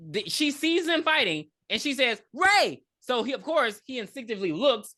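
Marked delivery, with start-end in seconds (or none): 2.3-2.8 high, loud acted shout; imitated urgent yell; calling out. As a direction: cry out the name to grab attention. Acts out Julie calling to Ray.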